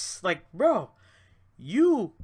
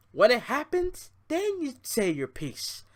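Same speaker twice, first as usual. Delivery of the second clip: ordinary talk, nothing marked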